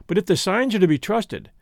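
ordinary delivery

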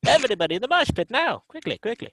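put-on high, fast old-time radio announcer; 1930s announcer. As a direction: hype it like a vintage radio host. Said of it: "I went nineteen thirties announcer".

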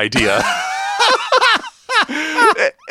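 very high shrieking burst of laughter. Real, not acted. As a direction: lose it completely.

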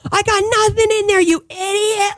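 high, fast, crying yell; woman chasing a purse thief screams at him; screaming at thief. Retold or imitated. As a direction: scream it fast like you've lost it. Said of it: "I'm screaming swears at him".

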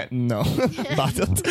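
imitating Fred Armisen, polite but contemptuous, laughing through it; a sniffy, laughing "No, bottled"; polite contempt. Quoting Fred Armisen's line to the podcast hosts, mock contempt. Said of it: "like he's trying to be nice, but so contemptuous".

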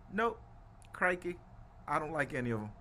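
put-on New Zealand accent for a role-play; a rough stab at a New Zealand accent; mock New Zealand accent. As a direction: answer as a New Zealander, lightly played. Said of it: "Anytime I do my New Zealand accent".

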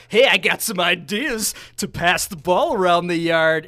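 put-on loud, lightly creepy old coach voice; a hint of a creeping, meddling old coach; creepy figurehead. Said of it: "that weird figurehead that's just kinda creeping around".

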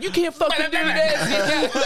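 mock indignant protest, high and drawn out; hammed-up high protest with laughter in it; defensive protest. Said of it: "They got so defensive".

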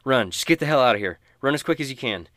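loud, fast urgent command; urgent shout. Acted. Inverna orders freed prisoners out, loud and fast. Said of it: "And she says".

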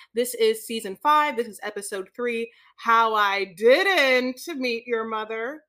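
slow, loud announcer read of the title; title announcement. Acted. Co-host asked to read the episode title, slow and loud. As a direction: draw out each word like a TV announcer. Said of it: "do the title of this for me".

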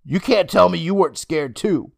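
indignant comeback; shooting back. A narrator's reading. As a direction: throw it back at him. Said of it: "Emma shot back".

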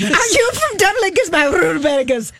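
rolled R's in a hammed-up Irish lilt; mock Irish accent. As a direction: toss off a jokey Irish pickup line. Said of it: "Why are you rolling your R's?".

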